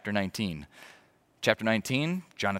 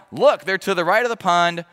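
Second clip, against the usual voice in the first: mock yell; 7 semitones higher, 9 dB louder than usual. Jonathan paraphrased calling to lad.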